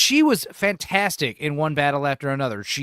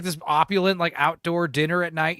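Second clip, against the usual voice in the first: ordinary talk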